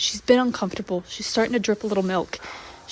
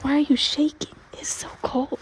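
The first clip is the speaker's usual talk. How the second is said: tender; 4 semitones higher, slower than usual. Pretend. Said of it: "She goes".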